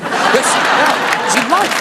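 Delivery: usual speaking voice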